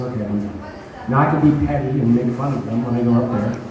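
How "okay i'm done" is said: a soft, resigned mutter to himself; quiet giving up. Retold or imitated. Comic quietly gives up on the two hecklers, soft and resigned. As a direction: let it drop, lower and softer. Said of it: "I just realized".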